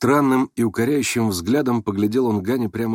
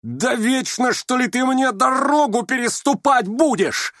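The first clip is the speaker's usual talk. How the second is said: high-pitched, drawn-out bellow; furious roar; 13 semitones higher, slower than usual. A narrator's reading. Ganya at the prince in utter frenzy, furious roar. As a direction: roar it at the top of the range. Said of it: "заревел Ганя".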